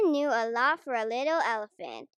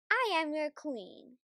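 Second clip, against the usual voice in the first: firm, higher regal tone; lightly voiced Queen; 4 semitones higher than usual. A narrator's reading. Queen reminding the driver who she is, regal. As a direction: pull rank with dignity.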